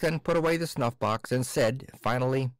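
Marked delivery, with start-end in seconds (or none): none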